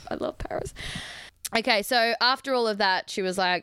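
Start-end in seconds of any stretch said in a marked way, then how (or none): 0.1-0.7 breathless whispered "I love Paris". Real, not acted. Assuring French listeners, whispered devotion. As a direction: confide it in a whisper. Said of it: "I just want you to know".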